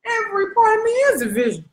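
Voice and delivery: high, proud declaration; confident self-affirmation. Retold or imitated. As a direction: proclaim it to yourself. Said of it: "I be like".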